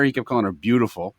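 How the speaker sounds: normal talking voice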